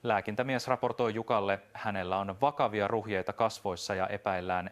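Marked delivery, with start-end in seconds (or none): none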